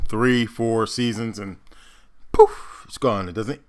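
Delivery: ordinary delivery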